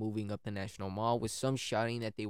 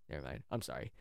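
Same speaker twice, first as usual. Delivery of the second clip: ordinary delivery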